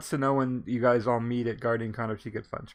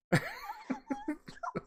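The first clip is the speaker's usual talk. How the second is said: high squealing laugh; 10 dB quieter than usual. Real, not acted. Host laughing hard.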